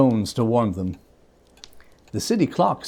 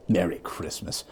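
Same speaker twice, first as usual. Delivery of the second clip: low sneer; 8 semitones lower, 8 dB quieter than usual. A narrator's reading. Scrooge sneers at the phrase.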